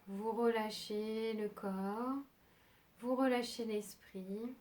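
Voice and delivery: soft, unhurried meditation voice with long pauses; calm yoga nidra guidance, leaving long silences; guided meditation. Acted. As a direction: guide them gently towards sleep, phrase by phrase.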